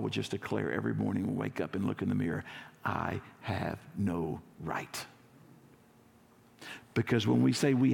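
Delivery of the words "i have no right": slow and quiet, each word weighed; hushed, humble confession; hushed solemn vow. Acted. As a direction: lay it down gently, no right at all. Congregation's morning declaration.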